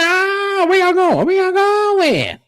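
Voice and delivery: very high sing-song mock voice, stretched at the end; imitating Carrie. Carrie calling after guests who are leaving, high drawn-out mock call. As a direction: call after them in a squeaky drawn-out plea. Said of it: "and Carrie said".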